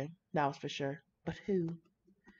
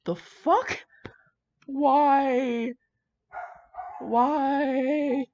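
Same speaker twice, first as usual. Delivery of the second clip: stunned; 8 semitones higher, 6 dB louder, much slower than usual. Real, not acted. Stunned by the plot twist.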